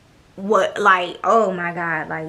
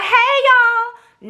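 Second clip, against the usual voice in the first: much higher, louder, sing-song hey; mock cheery greeting; 13 semitones higher, 5 dB louder than usual. Shown as a demonstration. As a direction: ham up a fake-friendly greeting. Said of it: "I'm not finna be like".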